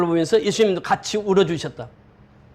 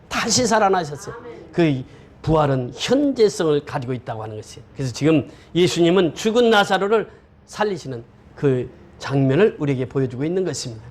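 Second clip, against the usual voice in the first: usual speaking voice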